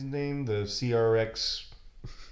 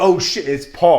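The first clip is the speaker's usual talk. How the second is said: mock [name]; 7 semitones higher, 13 dB louder than usual. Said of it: "[name] was like".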